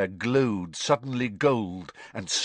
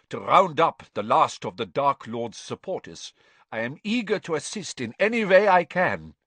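higher, pleading and oily; nervous prisoner pleading eagerly, higher and louder; scared and unctuous; 5 semitones higher, 4 dB louder than usual. A narrator's reading. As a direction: beg to be useful, fawning and frightened. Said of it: "his voice was very scared".

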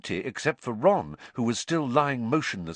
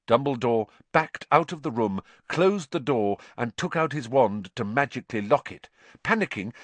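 ordinary delivery, nothing marked

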